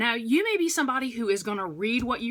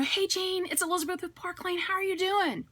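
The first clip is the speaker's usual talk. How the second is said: higher, friendly phone greeting; phone call greeting; 8 semitones higher than usual. Shown as a demonstration. Consultant demoing her opening call to Jane, friendly and higher. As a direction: greet her like she just picked up. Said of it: "And so I'm gonna say".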